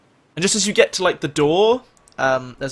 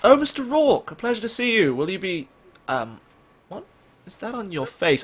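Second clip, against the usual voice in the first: high, polite butler greeting that falters; 6 semitones higher than usual. Acted. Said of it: "you hear him just say".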